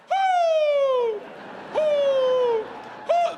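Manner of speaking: high, loud, shouted hoots; an old man hooting from his dock; old man's owl hoots. Retold or imitated.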